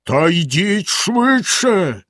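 bellow. Acted. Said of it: "знову заволав".

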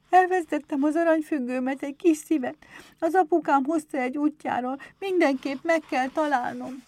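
put-on high, loud child's voice; little girl's voice. Berbel tells Éder of her lost pendant, high childlike plea. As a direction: play an upset child pleading over a loss.

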